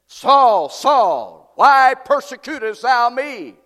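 loud, slow calling from heaven; a thunderous call to Saul; booming voice of God. Retold or imitated. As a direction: boom it out like a voice from the sky. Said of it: "Hear this voice saying".

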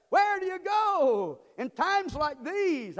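high, loud, slow preacher's cry; full-force preaching. Acted. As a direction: call it out slow and loud to the congregation.

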